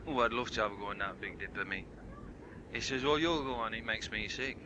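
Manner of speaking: low soft quick camp voice. Retold or imitated. Camp characters, low, soft, fast.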